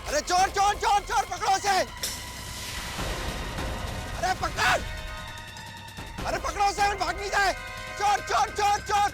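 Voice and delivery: high, urgent shouts after a thief; raising the alarm. Acted. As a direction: call out to stop a runaway thief. Crowd alarm, chasing a thief.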